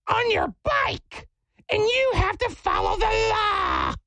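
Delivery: very high, screechy mock yell; slow, high-pitched caricature of a scolding lady. Lady scolds cyclist at stop sign, mock screechy yelling. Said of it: "So she starts yelling at me".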